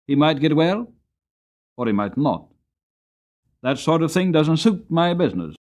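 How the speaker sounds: a hint of a callous master, dismissive; Skinner. A narrator's reading. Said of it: "said Skinner".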